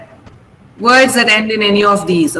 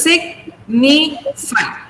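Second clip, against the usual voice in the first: sign, ni, fy split into separate beats; syllable by syllable; 3 semitones higher, much slower than usual. Shown as a demonstration. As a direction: chop the word into three beats. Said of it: "three syllables".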